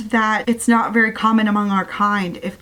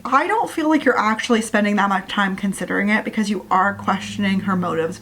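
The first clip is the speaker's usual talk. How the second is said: ordinary delivery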